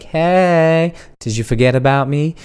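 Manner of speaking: high, drawn-out "hey"; coy come-on. Acted. Acts out a teasing breadcrumb text.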